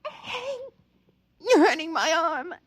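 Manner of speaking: sudden high cry of pain, then pleading; wife begs husband gripping her arm, pained and high; pained plea. Acted.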